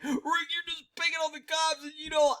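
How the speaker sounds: high put-on voice, mimicking complainers; mock the critics way up high. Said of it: "people are like".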